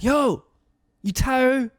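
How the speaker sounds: high, slow-stretched call from the street; high-pitched mock yell; retold yell. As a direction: imitate someone hollering up at a window. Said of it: "and they yell".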